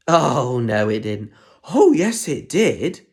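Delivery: rally the class like a panto; loud pantomime back-and-forth; panto call and response. Acted.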